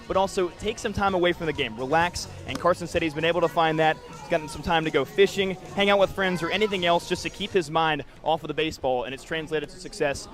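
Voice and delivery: ordinary delivery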